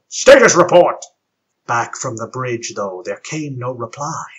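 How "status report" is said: loud commanding yell. Acted. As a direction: bark the order out. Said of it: "the captain looked up and yelled".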